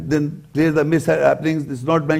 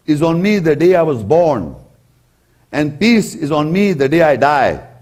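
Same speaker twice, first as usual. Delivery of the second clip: ordinary talk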